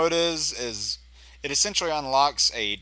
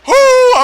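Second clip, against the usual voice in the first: loud whoop of relief; 17 dB louder than usual. Real, not acted. Relief after escaping attack.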